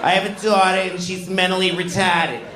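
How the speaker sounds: put-on high-pitched Boston accent; Boston teacher. Teacher griping to class about her daughter, high Boston imitation. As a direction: complain high and broad, Boston style. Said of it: "She used to tell us every day".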